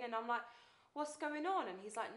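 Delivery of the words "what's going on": higher, pressing question; raised-pitch, mildly impatient asking; insistent repeat. Retold or imitated. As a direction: press for an answer, voice lifted. Asks again, pressing him.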